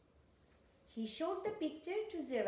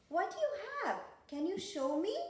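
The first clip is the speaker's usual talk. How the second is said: high, louder storybook question; eager curiosity; 9 semitones higher, 5 dB louder than usual. Acted. Mummy asks monkey about the hidden picture, eagerly curious and high. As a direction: ask with lifted, excited curiosity.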